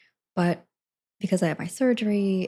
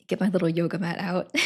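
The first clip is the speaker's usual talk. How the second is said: ordinary talk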